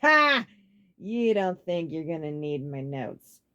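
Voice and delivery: loud ha then slow sarcastic echo; mock his words; scornful sarcasm; sarcastic laugh. A narrator's reading. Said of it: "let out a sarcastic laugh".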